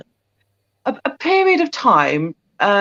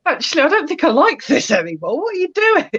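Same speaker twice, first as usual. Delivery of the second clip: nervous cat voice; 6 semitones higher than usual. Retold or imitated. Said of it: "Then he was like".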